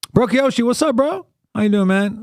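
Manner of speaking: high, lively hey-bro hello; excited greeting. Real, not acted. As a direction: greet him with a big grin. Greeting a friend who arrives.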